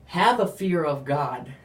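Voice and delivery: ordinary delivery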